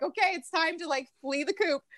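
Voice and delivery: a hint of a mother bird nudging; mama bird. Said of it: "The mama bird's like".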